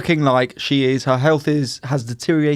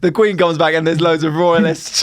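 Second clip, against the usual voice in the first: normal talking voice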